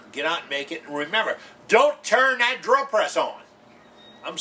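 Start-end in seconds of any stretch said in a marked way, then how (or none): 1.7-3.4 slow, loud and high, every word pressed; stern warning. Real, not acted. Host warning viewers off the drill press, slow and loud. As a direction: warn them off it like it could cost a finger.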